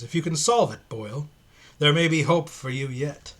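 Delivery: a mild put-on voice for a creepy priest; sinister priest.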